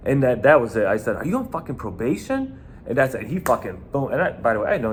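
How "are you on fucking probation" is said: high, pointed mock menace; intimidating question. Bouncer pressing a nervous kid, high and menacing. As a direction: lean on him with a sharp, high challenge.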